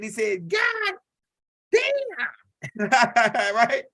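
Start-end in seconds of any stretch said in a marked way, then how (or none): 0.5-2.9 high strangled yelp of pain; shocked scream. Retold or imitated. Old man yanking his finger off the spark plug, high shocked scream. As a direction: scream like the current just hit you. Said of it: "hair was sticking up on his head".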